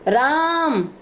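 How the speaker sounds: राम stretched long with a rising call; calling from afar. Shown as a demonstration. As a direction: draw out राम as if hailing someone far away. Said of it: "आवाज़ लगा के बुला रहे हो दूर से".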